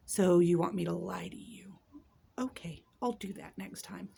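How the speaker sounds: muttered sarcasm under breath; sarcastic whisper. Real, not acted. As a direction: whisper it with a sneer. Sarcastic aside about the professor.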